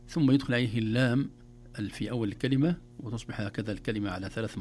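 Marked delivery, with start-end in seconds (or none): none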